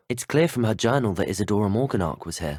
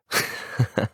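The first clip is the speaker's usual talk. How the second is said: short, breathy chuckle; light chuckle. Acted. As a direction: let out a brief little laugh. Character chuckles between lines.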